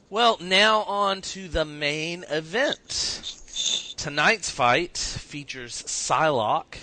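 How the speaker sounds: announcer voice. Acted. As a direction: build it up like a ring announcer. Announcer intro of main event.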